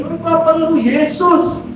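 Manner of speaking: imitating a preacher's high, slow declaration; pendeta. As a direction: proclaim it slowly from the pulpit. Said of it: "pendetanya maju ke depan ngomong gini". (menirukan pendeta berseru lambat dan tinggi)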